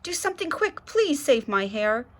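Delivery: high, urgent plea; pleading girl. A narrator's reading. Girl begging mother to save her hair. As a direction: beg for help fast. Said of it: "It looks like a forest".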